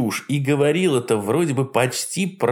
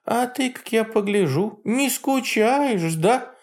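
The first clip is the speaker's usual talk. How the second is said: put-on high cackling old witch; sly old hag teasing; Baba Yaga voice; 11 semitones higher, slower than usual. A narrator's reading.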